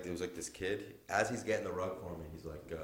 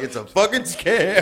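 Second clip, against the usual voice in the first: laughing aside; 12 semitones higher, 14 dB louder, slower than usual. Retold or imitated. Clerk's aside retold.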